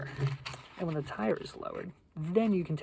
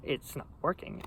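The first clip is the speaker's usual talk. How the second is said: normal talking voice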